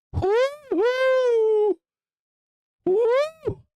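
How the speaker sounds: high, loud whooping cheer; excited whoop. Real, not acted. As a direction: whoop in delight at the chart. Cheering the price spike.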